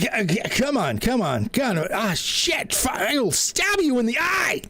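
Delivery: high, stammering twitchy cat; a nervous cat tripping over every word. Acted.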